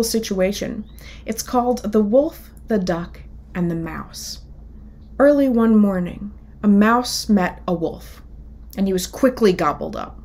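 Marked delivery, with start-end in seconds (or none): 5.2-10.2 lively picture-book read-aloud, characters voiced; children's story reading. A narrator's reading. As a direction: tell it like storytime for kids.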